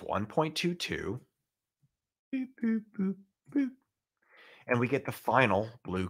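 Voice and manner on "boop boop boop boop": high squeaky cartoon boops; silly clown. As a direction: chirp each boop like a toy. Cartoon sound effect in lesson.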